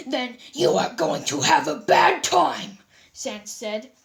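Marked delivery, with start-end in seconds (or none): 0.1-2.8 slow, ominous warning; lightly menacing threat. Acted.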